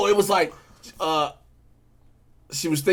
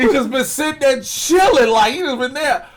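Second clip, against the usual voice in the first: higher, louder excited outburst; excited shout; 10 semitones higher, 7 dB louder than usual. Real, not acted. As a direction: yell it in disbelief, louder. Excited shouting about Batman.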